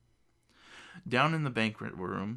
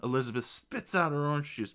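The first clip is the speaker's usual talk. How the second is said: usual speaking voice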